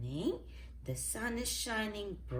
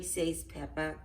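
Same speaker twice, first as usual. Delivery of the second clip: usual speaking voice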